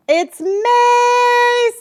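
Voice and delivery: high, loud, delighted cry; thrilled yell over a gift; excited shout. Real, not acted. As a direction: shout it out with joy.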